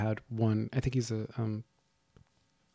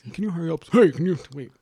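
slightly raised, put-on voice of an impatient customer; higher-pitched pretend Obama in a queue; Obama impersonation; 6 semitones higher, 4 dB louder than usual. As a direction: hurry someone along in a mock politician voice. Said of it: "He was behind me".

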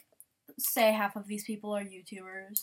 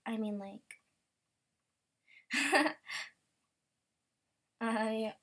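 ordinary delivery

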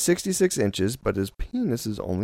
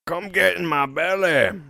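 hammed-up movie-villain impression, a bit higher; Fat Bastard; 5 semitones higher than usual. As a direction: bellow it like a greedy comic glutton. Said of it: "reminds me of that Mike Myers character".

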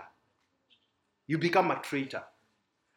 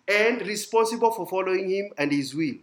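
normal talking voice

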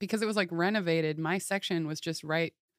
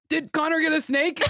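higher, louder question broken by laughs; eager laughing question; 9 semitones higher, 7 dB louder than usual. Retold or imitated. Friend mimics Allie greeting Maxim at the studio, eager. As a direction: ask it bursting with eagerness. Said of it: "she was like".

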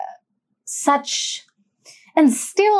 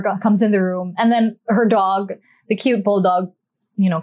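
ordinary talk, nothing marked

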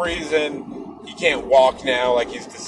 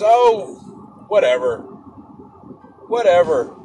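louder, brushing-off whatever; dismissive; 4 semitones higher, 6 dB louder than usual. Retold or imitated. Friend dismissing the cancer news, louder and offhand. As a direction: wave the worry away.

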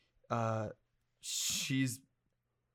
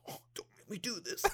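strained, hushed, higher mock-agonized plea; constipation acting; 7 semitones higher, 11 dB quieter than usual. Filmmaker mimicking his own role before the gun, overwrought acting. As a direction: imitate terrible acting, quiet and straining. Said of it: "I'm doing this, like, constipation acting".

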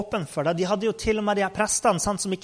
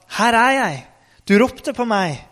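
louder, higher ready answer; eager call; 4 semitones higher, 5 dB louder than usual. Acted. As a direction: report in promptly, pitched up and projected. Samuel melder seg hos Eli. (ivrig, høyt svar)

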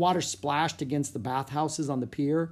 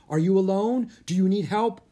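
loud, raised urgent questions; crying out; 7 semitones higher, 6 dB louder than usual. A narrator's reading. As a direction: call out in concern to children. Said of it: "the boy's father cried".

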